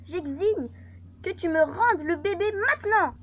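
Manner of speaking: high, loud ex-wife's ultimatum; demanding ex-wife. Acted. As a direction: act an ex-wife demanding her child. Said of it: "Ex-femme".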